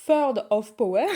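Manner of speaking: slow, hammy attempt at English accent; self-mocking English accent. Shown as a demonstration. Vlogger reads out a book title, joking English accent. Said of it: "avec mon accent anglais méga bien".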